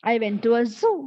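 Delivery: "zoo" said as "soo"; voiceless S in place of Z in "zoo"; mispronounced ZOO. Shown as a demonstration. Teacher gives the mistake in a sentence, "zoo" as "soo". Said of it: "people tend to pronounce it as".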